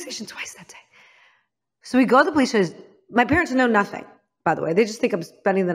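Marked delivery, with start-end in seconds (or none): 0.0-0.8 fast confiding whisper; whispered aside. Real, not acted. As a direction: tell the audience under your breath, fast. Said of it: "aside, whispered".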